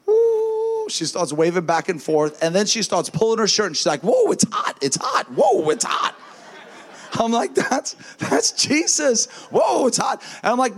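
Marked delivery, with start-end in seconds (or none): 0.0-0.9 sudden high whoop of surprise; imitating the lady's whoop. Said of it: "she starts going like this". 4.0-6.2 amazed cry. Retold or imitated. Lady feeling heat. 7.5-10.2 excited. Retold or imitated. Answering the excited lady.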